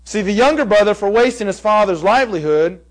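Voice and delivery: ordinary delivery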